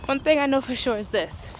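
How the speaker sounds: usual speaking voice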